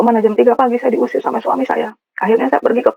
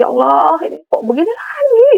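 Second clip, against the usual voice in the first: high, crying plea retold; tearful wail; 7 semitones higher than usual. Woman accused at night cries to God, wailing. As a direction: voice climbing steeply into a wail. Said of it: "Saya nangis".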